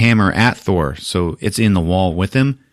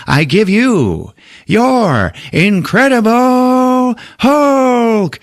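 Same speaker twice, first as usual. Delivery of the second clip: high, loud, drawn-out champion introduction; arena announcer; 5 dB louder, much slower than usual. Retold or imitated. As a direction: bellow it like a ring announcer, stretching every word. Grandmaster announces the champion to crowd.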